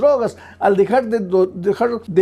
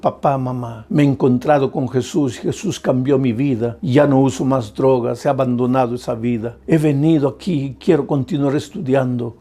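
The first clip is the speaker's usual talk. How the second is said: retold daughter's plea; 8 semitones lower than usual. Preacher voicing a daughter who comes home to her parents, a lightly voiced plea. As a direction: gently voice the daughter begging her parents to stop suffering.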